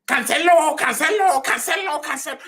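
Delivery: put-on high, loud German-accented fan chant. Streamer imitating Bayern fans screaming the name, German-accented chant. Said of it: "No, German accent".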